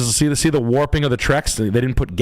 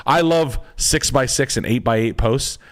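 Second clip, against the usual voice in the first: ordinary talk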